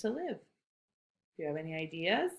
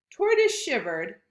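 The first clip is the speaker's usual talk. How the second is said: plain reading voice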